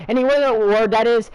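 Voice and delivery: usual speaking voice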